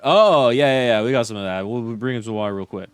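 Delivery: loud, quick "oh yeah" of a local handyman, settling down; a laid-back local who finally gets it; relieved maintenance guy. Retold or imitated. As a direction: rural hotel handyman rattling off his answer fast. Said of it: "the maintenance guy went".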